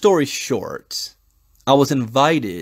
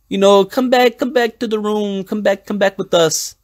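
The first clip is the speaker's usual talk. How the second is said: put-on coaxing girls; 7 semitones higher than usual. Imitating girls coaxing him back.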